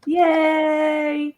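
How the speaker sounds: long, high, drawn-out "yay"; cheering. Real, not acted. Host asking everyone to cheer the winner, drawn-out cheer. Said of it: "cheer them up".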